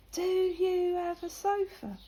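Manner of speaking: high, slow, drawn-out imitation; mock-incredulous quizzing. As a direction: spell it out as if to someone backward. Lad mockingly quizzes her on home.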